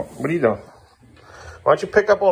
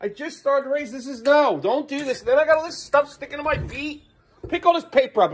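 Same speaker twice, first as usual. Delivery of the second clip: high-pitched, upset scolding; real exasperation, demanding an explanation; exasperated scolding; 6 semitones higher than usual. Real, not acted. Parent scolds child for kicking the door, exasperated.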